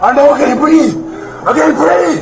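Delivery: pinned man crying out in panic; desperate cry. Real, not acted. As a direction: cry out that you cannot breathe. Man struggling to breathe, crying out.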